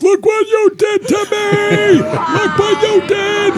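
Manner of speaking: shrill, slow, high-pitched shout; yelling. Retold or imitated. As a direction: wail it like a hurt kid, drawn out. Bleeding kid yelling at him.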